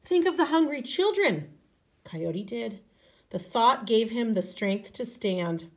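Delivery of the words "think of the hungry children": higher, urging spirit voice. Acted. Great Spirit urging weary Coyote, higher. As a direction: urge him on from above. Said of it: "the Great Spirit spoke".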